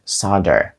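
soldier said as "SAH-der", meaning solder; American "SAH-der" for soldier, with silent l; American pronunciation. Shown as a demonstration. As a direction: drop the l and use an open "ah" in soldier. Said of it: "Це американська вимова".

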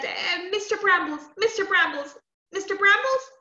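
put-on high child's repeated call; anxious summoning. Frightened girl calling magic friend three times, high and anxious. Girl in fear calls for help.

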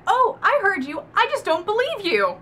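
high, loud mock-indignant retort; heated retort. Boyfriend to the woman who says she loves him, heated retort. Acted quote from lovers' argument.

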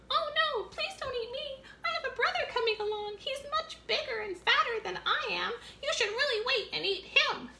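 very high little goat voice; tiny goat. Acted. Smallest billy goat begging the troll, very high.

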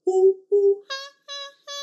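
loud ooh ooh then high ha ha; monkey hoots. Acted. Teacher doing monkey calls for toddlers. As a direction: hoot like a playful monkey.

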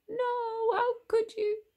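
very high, distraught wail; anguished cry. A narrator's reading. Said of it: "cried Jasmine".

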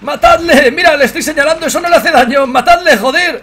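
high, loud frantic yelling. Real, not acted. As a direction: scream at your teammates to finish him. Yelling at teammates to kill.

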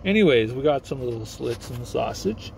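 ordinary talk

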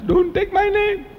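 high, drawn-out cry; mock inner shout. Speaker voicing his unspoken protest over his name, mock inner shout. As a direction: cry it out high, stretching each word. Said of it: "I didn't shout".

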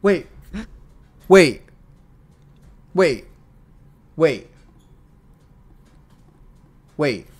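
stunned, stopping mid-read; wait, what, in disbelief; surprised wait. Real, not acted. Stops reading in disbelief.

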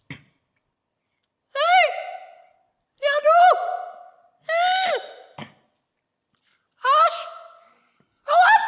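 very high, loud squeaky little creature voice. Pretend. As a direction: squeak insults up high like a tiny sprite. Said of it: "in meiner hohen Piepsi-Stimme".

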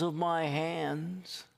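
God telling his people their names are on his palms; God speaking tenderly. Acted. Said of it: "he says".

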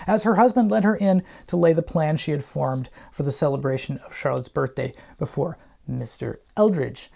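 ordinary talk, nothing marked

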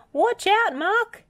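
high, loud shouted alarm; warning yell. A narrator's reading. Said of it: "he yelled".

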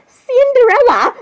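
high, loud, drawn-out name; hammed-up exclamation, slow and high; mock scolding call. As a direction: call out her name in pretend outrage. Character calls out another, acted scolding.

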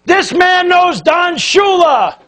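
high, slow, excited shout. Retold or imitated. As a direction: shout it out like he can't believe it. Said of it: "he shouts out".

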